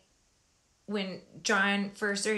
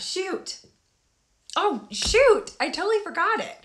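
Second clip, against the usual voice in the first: high, loud flustered oh shoot; suddenly rattled at losing the thread; 10 semitones higher, 5 dB louder than usual. Real, not acted. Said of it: "Now I forgot".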